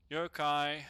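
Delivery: usual speaking voice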